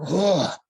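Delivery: imitated electrified burst of breath; juiced-up gasp. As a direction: mimic being jolted full of power. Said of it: "I was instantly juiced".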